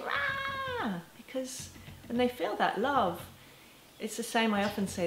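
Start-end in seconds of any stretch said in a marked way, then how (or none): 0.0-0.9 mock child wail. Parent imitating a child bursting into tears, high howl. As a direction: let out a child's howl, much higher. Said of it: "start crying again more intensely".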